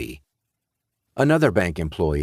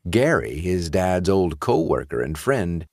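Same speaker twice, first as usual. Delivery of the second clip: ordinary delivery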